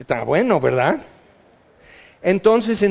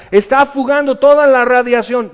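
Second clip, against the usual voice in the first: loud, higher panicked report; alarmed shout; 6 semitones higher, 6 dB louder than usual. Retold or imitated. Engineer below deck shouting a loud, panicked warning. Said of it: "para que él diga".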